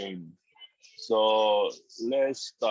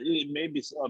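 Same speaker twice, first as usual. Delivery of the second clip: normal talking voice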